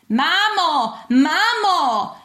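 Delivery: high, loud call for mum. A narrator's reading. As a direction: shout through the house for your mother. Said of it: "zawołałem". (głośne wołanie mamy)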